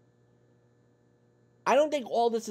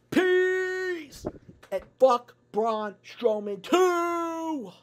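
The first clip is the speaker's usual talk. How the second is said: shouted sign-off; 10 semitones higher, much slower than usual. Real, not acted.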